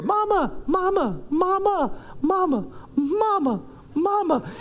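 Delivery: put-on high-pitched child calling Mama; little kids' voices. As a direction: nag for mom in a tiny voice. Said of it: "I hear these little voices".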